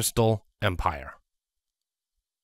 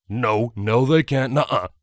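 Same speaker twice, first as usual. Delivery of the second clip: protesting; 5 dB louder than usual. Acted. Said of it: "however futile it was".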